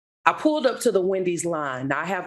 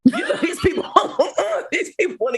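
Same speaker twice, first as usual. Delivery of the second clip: high laughing burst; 13 semitones higher, 4 dB louder, faster than usual. Real, not acted. Woman recalling her refusal, laughing. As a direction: laugh through the words.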